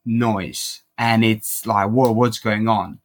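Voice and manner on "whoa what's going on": ordinary delivery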